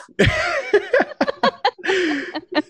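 high breathy laugh. Real, not acted. After recalling the apology, high laugh.

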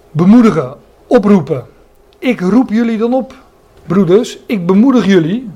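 preacher's encouraging call; fervent preaching. Acted. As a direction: urge them on from the pulpit.

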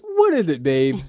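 high, sing-song mock-sweet question; chipper jolly voice. Husband mocking his own fake-cheerful reply to girlfriend, chipper voice. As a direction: overdo the cheerful partner. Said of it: "in my chipper, jolly voice".

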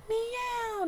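high, small mock meow; a preacher mocking the devil as a house cat; tiny cat meow. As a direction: make the devil a harmless kitten.

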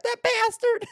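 very high, loud comic squeal; shrieking pretend fury; mock outrage. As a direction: spit it out in hammed-up indignation. Acting out scorned woman's outburst.

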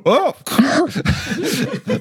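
high squeaky startled cry. Acted. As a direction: yelp in surprise. Hemfeet tripping, voiced.

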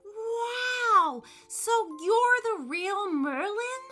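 put-on high, loud and thrilled kid; excited boy. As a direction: gasp it out in wonder. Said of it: "said William".

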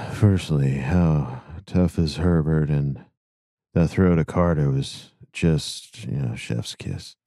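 low, slow and worn out; tired dad voice. Acted. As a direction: read it like an exhausted new father. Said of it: "Tired dad voice for the win".

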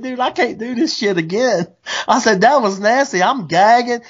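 high, whiny retold griping; mock disgusted complaint. Telling his friend the gutting made him gag, high and whiny.

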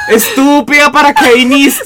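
high, laughing scolding yell; mock angry scolding. Retelling the photo moment to friends, high mock scolding. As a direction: scold loudly, half laughing. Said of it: "y yo".

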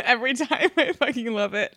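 amused, laughing as you speak. Real, not acted. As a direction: giggle out the words, delighted. Cracking up at co-host's reaction.

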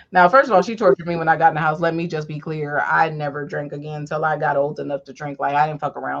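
normal talking voice

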